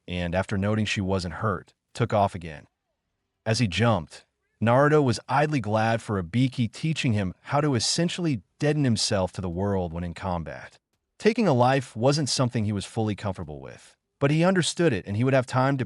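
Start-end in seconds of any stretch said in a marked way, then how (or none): none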